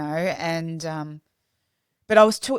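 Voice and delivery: ordinary delivery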